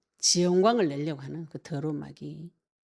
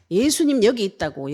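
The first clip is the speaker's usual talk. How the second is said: loud cry, a bit higher; shouting out; 4 semitones higher, 8 dB louder than usual. Retold or imitated. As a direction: call it out loudly like a crowd yelling. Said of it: "막 고함 지르면서". (고함 지르듯 크게 외치기)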